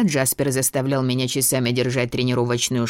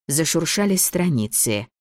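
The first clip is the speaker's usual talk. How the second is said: ordinary delivery, nothing marked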